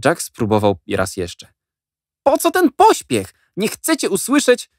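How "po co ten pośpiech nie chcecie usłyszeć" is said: put-on pleading boy. A narrator's reading. Jack pleads.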